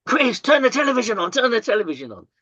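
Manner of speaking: high, fast, urgent call to turn the TV on; urgent neighbor. Retold or imitated. Neighbor at the door telling Chris to switch on, urgent and high. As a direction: burst in with alarm, quick and high.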